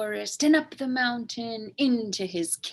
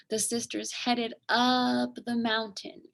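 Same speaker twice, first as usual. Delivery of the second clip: plain reading voice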